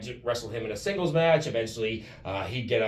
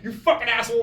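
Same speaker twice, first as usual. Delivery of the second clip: high, raised-voice retold curse; mock exasperated cursing; 11 semitones higher, 6 dB louder than usual. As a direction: curse a giggling prankster in mock outrage. Acted cursing at giggling opponent.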